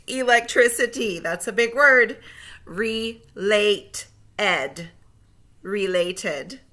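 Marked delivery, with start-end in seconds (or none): 2.6-4.9 slow, split into syllables; segmented syllables. Shown as a demonstration. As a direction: split it into two slow beats. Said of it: "say the syllables in segments".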